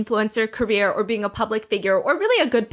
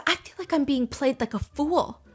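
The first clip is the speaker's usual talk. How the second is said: ordinary talk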